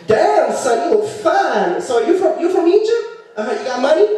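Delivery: put-on high-pitched, impressed girlfriend voice. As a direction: play her sizing him up, pitched high.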